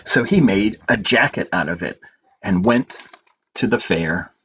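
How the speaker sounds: story narration. A narrator's reading. Librarian reading a picture book to children, gentle storytelling.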